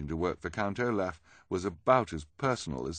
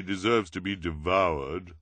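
louder put-on character voice at normal pace; earnest objection; 5 dB louder than usual. A narrator's reading. Violet's worried objection in story.

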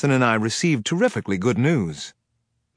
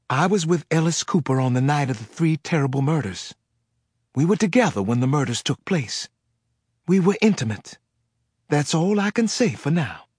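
higher, tense Southern voice; frightened Southern woman; 4 semitones higher than usual. Acted. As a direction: confess it nervously with a Southern accent. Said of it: "the caller was frightened".

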